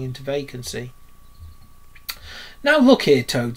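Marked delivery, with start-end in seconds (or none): 2.6-3.6 sharp rebuke. A narrator's reading. Rat confronts Toad on the road, sharp loud rebuke. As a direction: pull him up sharply. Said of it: "said Rat sharply".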